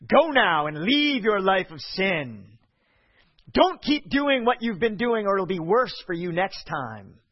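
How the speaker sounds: a stern judge warning the sinner; harsh condemning Jesus. Shown as a demonstration. Preacher voicing Jesus the wrong way, as a harsh threat. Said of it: "my tone of voice is intentional".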